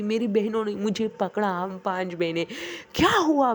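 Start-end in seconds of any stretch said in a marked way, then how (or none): 2.9-3.5 high, loud, urgent what-happened; alarmed shout. Retold or imitated. Sisters shouting at the terrified little girl, alarmed. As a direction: call out in alarm to the screaming girl. Sisters grab the screaming child.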